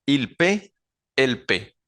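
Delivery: "paie" pronounced just "pe", the written plural ending silent; suena solo pe. Shown as a demonstration. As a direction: model "il paie, elle paie" with a short "pe". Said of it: "suenan solamente como si fuesen dos". ("paie" como "pe", sin pronunciar la terminación)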